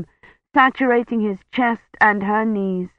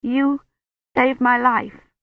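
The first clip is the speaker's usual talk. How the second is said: high, sob-choked words; voice catching in a sob; 6 semitones higher, slower than usual. A narrator's reading. Kivrin thanks dying Roche, high, voice catching in a sob. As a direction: let the sob break through. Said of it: "her voice caught in a sob".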